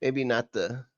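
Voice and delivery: usual speaking voice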